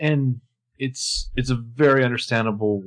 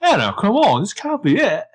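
voicing Jordan; 10 semitones higher than usual.

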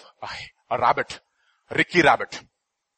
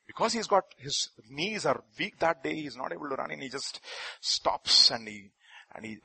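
ordinary talk

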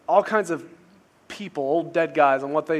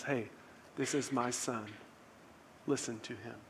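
low, very soft retold voice; hushed divine voice; 4 semitones lower, 16 dB quieter than usual. Preacher voicing what the disciples heard, low and hushed. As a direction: let it drop to a grave hush.

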